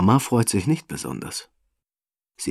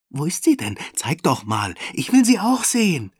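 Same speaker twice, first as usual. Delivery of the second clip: put-on excited children; 9 semitones higher than usual. Kinder drängen sich um Benny, aufgeregt durcheinander. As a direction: squeal it like a crowd of eager kids. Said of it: "schreien sie durcheinander".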